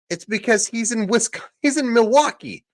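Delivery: exasperated outburst. Real, not acted. Exasperated realization about rally location.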